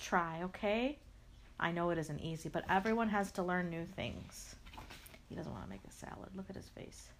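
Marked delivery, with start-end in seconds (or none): 5.3-7.1 whispered aside. Acted. Aside to listener about bunny.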